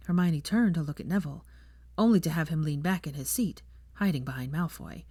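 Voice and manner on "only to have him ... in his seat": usual speaking voice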